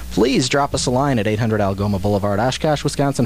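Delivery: normal talking voice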